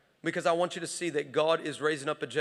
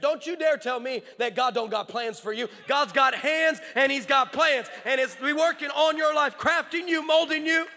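impassioned preaching; 7 semitones higher, 6 dB louder than usual. Real, not acted. Preacher insisting God has plans for you, impassioned. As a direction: build to loud, high preaching. Preaching builds to full force.

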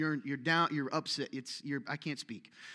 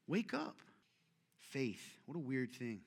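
normal talking voice

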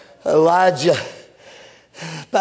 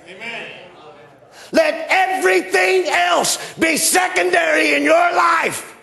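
preaching at full force; 7 semitones higher than usual. Acted. Preacher repeating the prophet to the congregation, full-force preaching. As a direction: hammer it home the second time.

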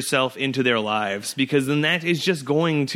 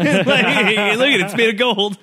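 laughing mock show-off; 8 semitones higher, 5 dB louder, slower than usual.

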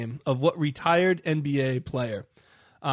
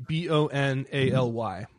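spelling it out. Shown as a demonstration. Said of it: "How do you spell that again?".

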